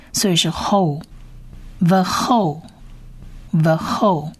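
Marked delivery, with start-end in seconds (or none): 1.8-4.3 "the whole" as Hebrew "ve-khol", slow and separated; Hebrew pronunciation demo. Shown as a demonstration. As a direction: model "the whole" slowly, twice, for learners. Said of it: "我们把它发成后". (慢速示范希伯来语“the whole”，读作 ve-khol)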